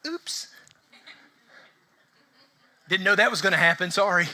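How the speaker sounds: mock God shrugging off a mistake; mock careless oops. Pastor imagining a careless God apologising, mock offhand oops. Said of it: "why would he be like".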